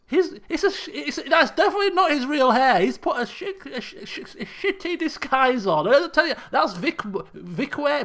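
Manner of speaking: high, loud, excited outburst; excited realization. Real, not acted. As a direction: blurt out the discovery in amused disbelief. Realizes who is in disguise.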